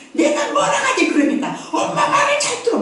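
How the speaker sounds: high, scolding mother's voice; shrill told-you-so nag; nagging mother. Retold or imitated. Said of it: "막 이러잖아".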